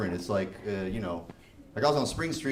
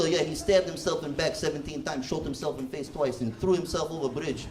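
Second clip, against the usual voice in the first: mock Russian report.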